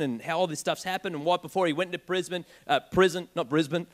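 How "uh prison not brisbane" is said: normal talking voice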